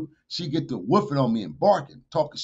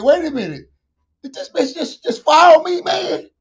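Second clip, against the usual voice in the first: disbelief; 11 semitones higher, 9 dB louder than usual. Retold or imitated. Shocked at being followed.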